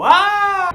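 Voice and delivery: sudden high scream. Retold or imitated. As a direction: stand frozen and scream. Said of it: "stand there and go".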